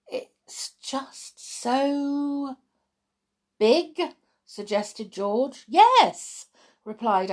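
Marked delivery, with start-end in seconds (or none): 0.1-4.1 high, very drawn-out words; awed hesitation. A narrator's reading. George daunted by the dream. 5.7-6.5 loud, high exclamation; emphatic yes. A narrator's reading. Amber cuts in emphatically.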